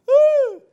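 loud high excited whoop. Real, not acted. Preacher whooping after shouting hell. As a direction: let out a big whoop.

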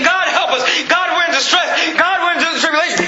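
preacher acting out a desperate plea; crying out for help. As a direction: cry out as people in distress. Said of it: "These people are crying out. They're saying".

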